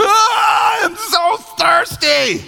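put-on high, drawn-out child's wail; hammed-up thirsty child's howl; wailing kid. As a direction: scream it like a desperate five-year-old. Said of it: "And it's your kid going".

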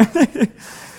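high bursts of laughter; real laugh. Real, not acted. Pastor laughs at his son's fireworks oohs.

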